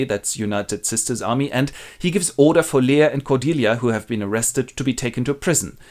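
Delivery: ordinary talk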